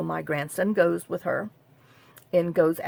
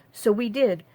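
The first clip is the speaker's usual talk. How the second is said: normal talking voice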